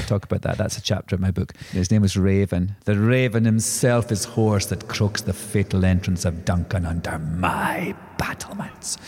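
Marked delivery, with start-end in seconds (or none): 2.8-9.0 slow, low, dramatic Shakespeare recital fading to a whisper; theatrical Macbeth line. Pretend. Actor quoting Shakespeare after naming his ex, slow and hushed. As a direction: intone it like Lady Macbeth on stage, sinking to a hush.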